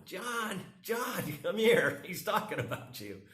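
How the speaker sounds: wife calls husband over to watch, high laughing call. Retold or imitated. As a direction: call across the boat to John, laughing. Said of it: "his wife is watching this video right now going".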